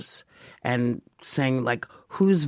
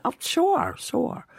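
much higher, airy "sure, sure" brushing it off; breezy mock agreement; slower than usual. Answering Mike Nichols, assuming he's kidding, breezy and high. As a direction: toss it off like he's joking. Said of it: "And I said".

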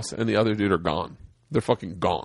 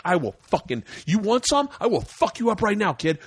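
mock bravado, pitch climbing; retold tough talk; 8 semitones higher than usual. Squaring up to actor outside bathroom, high mock bravado. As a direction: play at talking tough. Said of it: "I start talking shit like I know".

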